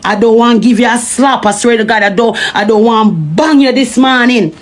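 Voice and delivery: ordinary talk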